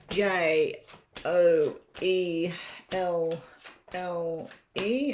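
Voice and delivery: J, O, E, L, L, E spelled slowly, one letter at a time; spelling out letters. Shown as a demonstration. Tarot reader spelling the viewer's name for her reading. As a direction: spell the name out with small pauses. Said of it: "we'll do your name twice".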